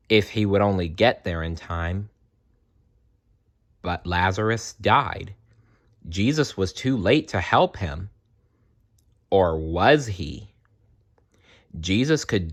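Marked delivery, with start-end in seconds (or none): none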